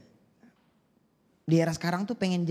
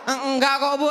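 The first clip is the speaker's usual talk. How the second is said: high nervous laughing; mock flustered vendor; nervous shopkeeper laugh; 6 semitones higher than usual. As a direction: laugh it off awkwardly. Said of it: "Abang-abang".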